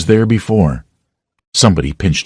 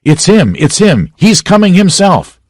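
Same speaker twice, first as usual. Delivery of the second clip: high excited shouts from the crowd; shouting; 11 semitones higher, 4 dB louder than usual. A narrator's reading. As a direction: cry out as he is spotted. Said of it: "and voices shouted".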